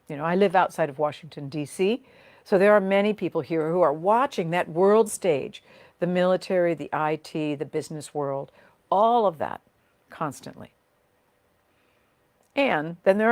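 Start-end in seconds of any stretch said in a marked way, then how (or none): none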